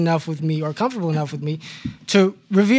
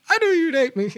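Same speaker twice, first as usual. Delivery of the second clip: high, fearful little whine; mock fearful; 10 semitones higher than usual. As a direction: act scared he'll be rejected. Friend's fearful quote retold.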